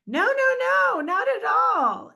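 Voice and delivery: high, loud rush of reassurance, read aloud; emphatic denial. A narrator's reading. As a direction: protest it high and loud, as the friend in the book. Reads friend's hasty denial aloud.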